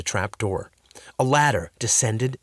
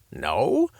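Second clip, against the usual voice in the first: ordinary delivery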